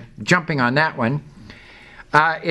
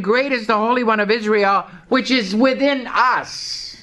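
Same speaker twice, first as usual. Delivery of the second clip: mock shout of praise; 6 semitones higher than usual. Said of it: "And they're shouting".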